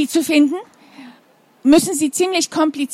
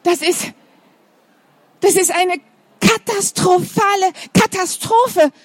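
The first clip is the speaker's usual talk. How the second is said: flustered teacher; 7 semitones higher than usual. Acted. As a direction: cry it out in despair, high. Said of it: "ruft sie".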